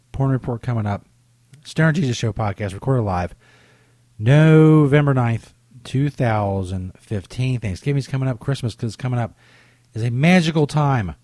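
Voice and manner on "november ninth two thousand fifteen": slow announcer read. Acted. Announcing the recording date.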